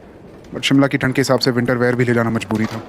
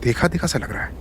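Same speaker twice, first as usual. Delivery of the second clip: soft half-whispered thought; muttering to himself; 2 semitones higher, 6 dB quieter than usual. Acted. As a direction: murmur it privately. Inner thought about stranger.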